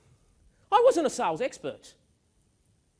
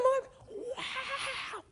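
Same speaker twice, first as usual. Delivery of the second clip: laughing wow; 6 dB quieter than usual. Retold or imitated. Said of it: "People go".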